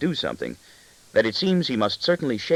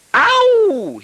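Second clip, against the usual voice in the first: a piercing, much higher pained cry; child's wail; 9 dB louder than usual. A narrator's reading. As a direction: howl out as if your foot was stepped on. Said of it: "he wails".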